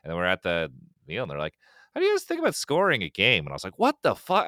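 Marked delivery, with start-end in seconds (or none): 1.9-3.4 much higher offhand asking; mock casual pitch. Rockstar people asking. 3.8-4.5 much higher, louder shocked burst; stunned disbelief. Retold or imitated. Stunned at the offer.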